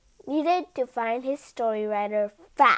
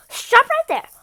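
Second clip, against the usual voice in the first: interrupting shout; 10 semitones higher, 9 dB louder than usual. Acted. The king interrupts the story.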